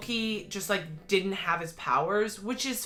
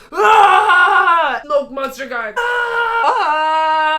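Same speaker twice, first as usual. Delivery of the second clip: loud, high fan scream; yelling at the TV; 10 semitones higher, 15 dB louder, much slower than usual. Retold or imitated. Fan on the couch yelling at the TV, loud high scream. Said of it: "on my couch yelling at my T V".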